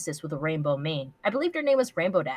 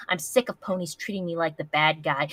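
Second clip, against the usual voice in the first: normal talking voice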